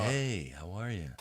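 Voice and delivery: lightly softened sweet greeting. Retold or imitated. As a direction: soften it to sound gentle. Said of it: "they soften their voice".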